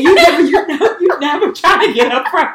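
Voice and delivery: ordinary delivery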